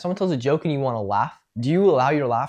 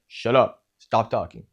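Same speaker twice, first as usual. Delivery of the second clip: louder, curt and cold; harsh scolding; 2 semitones lower, 4 dB louder than usual. Shown as a demonstration. Parent shutting a child down with harsh anger. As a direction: snap it at the child. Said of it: "It's more like".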